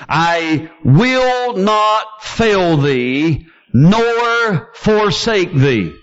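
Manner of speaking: slow, deliberate, every word weighed; emphatic slow scripture quote; plainly stated. Shown as a demonstration. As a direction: spell it out slowly, rising on the last words. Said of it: "plainly saying".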